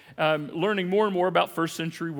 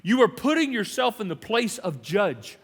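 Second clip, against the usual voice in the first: usual speaking voice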